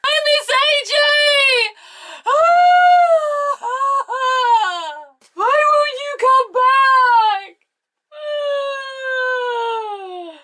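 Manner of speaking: high, hammed-up crying wail; mock wailing. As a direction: wail it like a melodramatic sob. Mocking Brie's crying.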